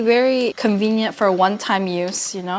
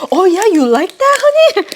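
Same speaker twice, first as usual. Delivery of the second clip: teasing voice; 11 semitones higher, 7 dB louder than usual. Pretend. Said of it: "Be like".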